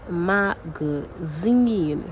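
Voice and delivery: very slow "magazine" with a weak middle syllable; "magazine" drawn out syllable by syllable; unstressed syllable demo. Shown as a demonstration. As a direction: stretch the final -zine of "magazine", no pressure on the middle. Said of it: "don't apply any pressure while saying your unstressed syllable".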